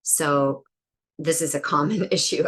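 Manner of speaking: ordinary talk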